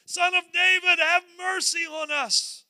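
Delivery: high, loud, drawn-out shout; calling out. Acted. Two blind men shouting to the passing Jesus, calling out. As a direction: holler for help down the street. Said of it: "two blind men are standing over here going".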